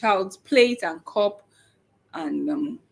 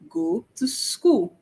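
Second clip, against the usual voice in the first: slow, higher, clear modeling of Go to school; corrected model; 5 semitones higher, much slower than usual. Shown as a demonstration. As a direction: say Go to school slowly and a bit higher, each word clear. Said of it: "you can say".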